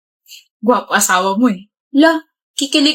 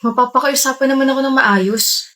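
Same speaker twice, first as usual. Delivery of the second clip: usual speaking voice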